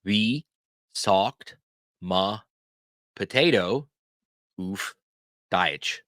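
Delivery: each word alone with a long pause; very slow, spaced out for repeating; word-by-word teaching demo. Shown as a demonstration. Teacher shows learners how to ask for a word, word by word. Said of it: "ask the question this way".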